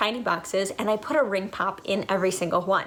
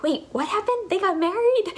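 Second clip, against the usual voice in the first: imitated kids' confusion; 11 semitones higher than usual. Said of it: "they were like".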